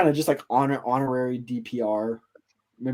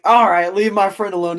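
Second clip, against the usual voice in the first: louder, higher battle call; heroic shout; 5 semitones higher, 7 dB louder than usual. Acted. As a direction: shout it as you open fire to save a friend. Kid saves his friend, defiant.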